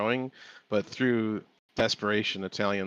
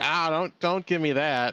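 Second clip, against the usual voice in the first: higher-pitched mock exasperation; retold protest; 7 semitones higher, 4 dB louder than usual. Man retells his date rejecting his correction, mildly exasperated. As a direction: imitate her brushing it off, pitched up.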